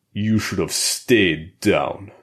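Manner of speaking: put-on slow, Russian-accented taunt; lightly growled in a Russian accent; Russian brute growl. A narrator's reading. Boris taunting Colt mid-fight, slow Russian accent. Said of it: "Boris growled at Colt".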